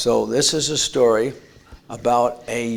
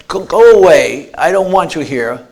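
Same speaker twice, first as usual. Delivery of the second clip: loud, higher, furious send-off; angry shout; 5 semitones higher, 11 dB louder than usual. Retold or imitated. Father shouting at the son outside to leave. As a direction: drive the boy away with a shout.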